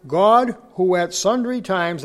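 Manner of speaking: scripture reading. Acted. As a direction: proclaim the verse slowly to the congregation. Reads Hebrews aloud.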